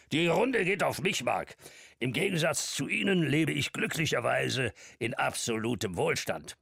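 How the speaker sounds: put-on high, hearty old man making an offer; jovial old man. A narrator's reading. Old man offering Mark a deal, hearty and high. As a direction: boast cheerfully.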